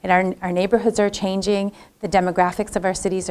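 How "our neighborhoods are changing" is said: ordinary talk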